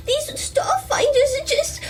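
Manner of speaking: put-on high, sweet little kid voice; cute child voice. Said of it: "T J's gonna try and butt in here".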